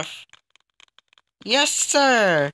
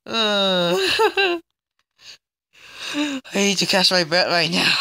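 ordinary talk, nothing marked

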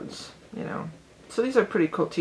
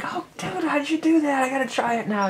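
much higher, eager amazed cry; excited; 9 semitones higher than usual. Retold or imitated. Imitating herself amazed at her brother's pool shot, high eager gush. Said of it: "I would get excited".

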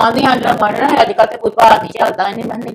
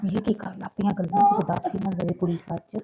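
much softer nervous aside to herself; worried aside; 3 semitones lower, 14 dB quieter than usual. Acted. Aunt fearing the girl will tell the truth, softly aside. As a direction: keep it under your breath.

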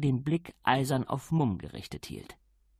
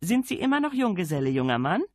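higher, louder call through the door; 7 semitones higher, 4 dB louder, faster than usual. A narrator's reading. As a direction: call back into the room. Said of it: "Lady Sybil rief".

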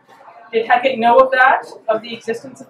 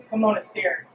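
plain reading voice

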